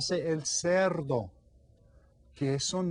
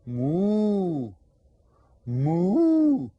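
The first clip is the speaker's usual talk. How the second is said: cow moo; 4 semitones higher, 6 dB louder than usual. Shown as a demonstration. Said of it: "La vaca dice".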